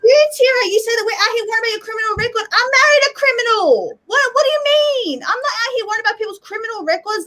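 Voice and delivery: exasperated outburst. Real, not acted. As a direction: fire it back at her, rattled and fast. Angry outburst at Tira.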